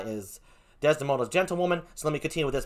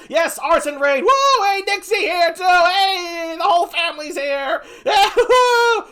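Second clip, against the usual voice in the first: very high, loud cheers; real excited whooping; 7 dB louder, slower than usual. Real, not acted. Streamer greets the whole family arriving, excited whooping. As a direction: whoop and holler for the new arrivals.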